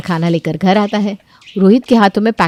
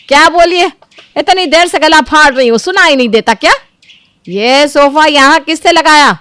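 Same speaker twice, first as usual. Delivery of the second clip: higher, loud furious mother-in-law; angry scolding; 7 semitones higher, 7 dB louder than usual. Acted. Mother-in-law berating Chanda about the sofa, angry. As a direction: tear into the daughter-in-law.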